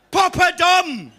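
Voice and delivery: high, loud, drawn-out holler; mocking nickname call. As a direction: call out the nickname across the shop. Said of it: "what they called poor Dominic in the shop".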